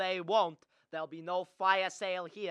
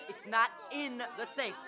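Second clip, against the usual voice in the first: ordinary delivery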